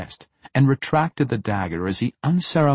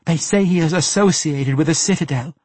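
higher, louder captive woman; fearful disclosure; 8 semitones higher, 7 dB louder than usual. A narrator's reading. Captive informs the assassin about a feared man, fearful disclosure. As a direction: give it up in a high, anxious voice.

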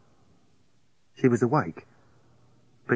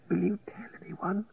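faint fearful whisper; 9 semitones higher, 8 dB quieter than usual. A narrator's reading. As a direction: whisper it anxiously. Sick woman whispers fearfully.

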